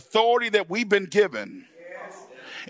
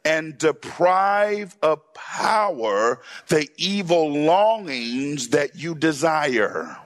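slow, deliberate scripture reading; 4 semitones lower, slower than usual. Shown as a demonstration. Preacher reading scripture slowly to church. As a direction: read each word slowly for the congregation. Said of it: "Say it again".

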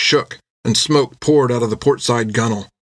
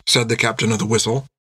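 usual speaking voice

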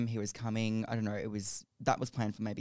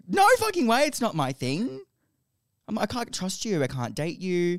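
shocked refusal; 7 semitones higher, 6 dB louder than usual. Retold or imitated.

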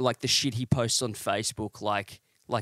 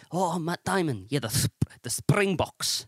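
exaggerated South African accent, pitched up; mock South African accent; 5 semitones higher than usual. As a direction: imitate a South African rugby player. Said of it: "South African af-, accents".

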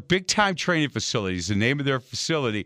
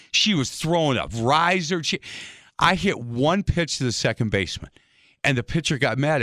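usual speaking voice

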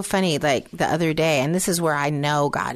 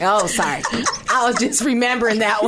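giggling apology, higher and louder; laughing; 6 semitones higher, 4 dB louder than usual. Real, not acted. Laughing at own joke.

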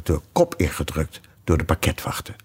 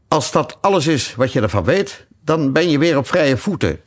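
king; 6 semitones higher than usual. Acted. King speaks in the trial.

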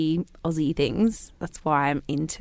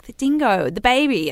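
emphatic reminder; 5 semitones higher, 6 dB louder than usual. Retold or imitated. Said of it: "Mum's like".

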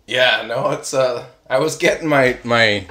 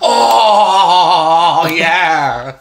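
satisfied plant; 5 semitones higher, 6 dB louder than usual. Pretend. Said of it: "it's like".

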